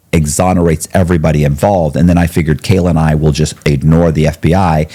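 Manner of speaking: usual speaking voice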